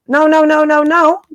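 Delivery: ordinary talk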